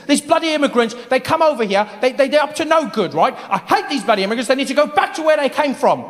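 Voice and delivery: put-on light British accent, ranting; mock a grumbling British bigot; imitating bigoted Brit. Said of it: "people saying the weirdest things".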